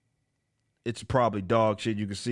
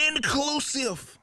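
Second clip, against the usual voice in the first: very high, drawn-out "Inclusive"; mocking echo; slower than usual. Host mocking the comic's favourite term, high and slow. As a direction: stretch the word out in a high mock voice.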